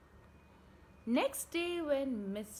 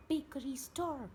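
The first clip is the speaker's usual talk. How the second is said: plain reading voice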